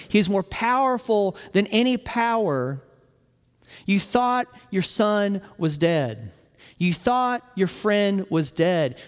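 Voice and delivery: slow, weighty proclamation with spaced words; emphatic preaching. Real, not acted. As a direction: proclaim it deliberately, landing each word.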